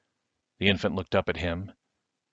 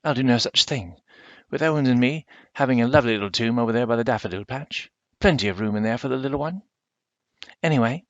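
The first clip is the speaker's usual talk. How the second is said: higher and louder, huffy refusal; indignant country woman; 5 semitones higher, 4 dB louder than usual. Acted. Missus Owens refuses Silas's crypt, louder and higher. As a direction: brush off the offer with proud fuss.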